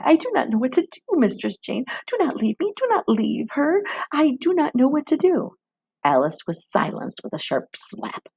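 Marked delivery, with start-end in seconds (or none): 0.0-5.5 high, tearful begging; crying, pleading. A narrator's reading. Frightened girl begging the midwife not to leave, tearful. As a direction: pitch breaking high as she begs through tears. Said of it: "Alice began to cry".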